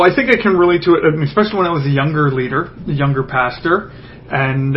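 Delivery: ordinary delivery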